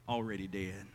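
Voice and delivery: grave murmured echo; hushed echo. Acted. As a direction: let it fall away to a near whisper. Echoes the grim fact.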